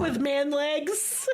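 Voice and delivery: slow, high, breaking into laughter; real laughter. Real, not acted. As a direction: squeeze it out through giggles. Cracking up at own story.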